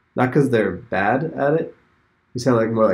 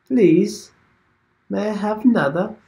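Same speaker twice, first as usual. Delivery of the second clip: put-on high, pleading Oliver Twist voice, English accent; mock English accent; 10 semitones higher, 4 dB louder than usual. To the English players, mock English beggar plea. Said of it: "Is that how you guys sound?".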